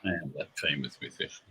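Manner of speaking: low, very quiet self-importance; hushed and low, quietly grand; slight swagger. Retold or imitated. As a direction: imitate an understated celebrity swagger. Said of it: "this sort of slight swagger".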